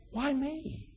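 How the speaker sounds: hushed, pleading why me; soft plaintive why me. Pretend. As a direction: ask it softly, as if hurt. Preacher voicing the question softly.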